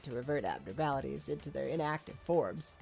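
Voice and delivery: nerdy character voice, slowing at the end; nerd voice. Acted. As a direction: talk like a know-it-all nerd. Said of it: "the nerd voice".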